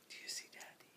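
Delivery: quick excited whisper. Acted. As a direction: breathe it fast and secret. Peekaboo with baby, hushed.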